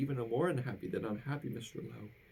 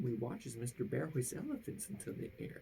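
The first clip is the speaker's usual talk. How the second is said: ordinary delivery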